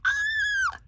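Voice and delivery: short, very high squeal; delighted squeal. Real, not acted. Crafter seeing a sock monkey tag, squeal. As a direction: squeak with joy.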